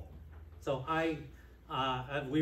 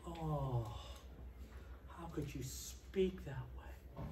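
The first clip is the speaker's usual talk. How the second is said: much softer, slow, hurt reproach; gentle kindness; 12 dB quieter, slower than usual. Retold or imitated. The kind one gently reproaching the prophet, soft and slow. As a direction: soften it right down, wounded. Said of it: "The one with kindness would say".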